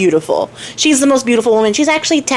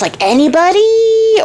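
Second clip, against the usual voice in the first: desperate plea; 10 semitones higher, slower than usual. Retold or imitated. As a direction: plead like a king out of options. King begging for any groom.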